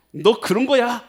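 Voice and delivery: high, loud challenge; angry challenge. Retold or imitated. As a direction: snap back loud and high. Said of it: "하고는 요한이 갔을 거".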